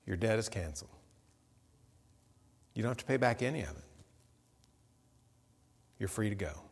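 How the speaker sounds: soft, quiet king releasing a debtor; gentle pardon. Acted. A king forgiving a huge debt, quiet and gentle. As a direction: let him go, softly and kindly.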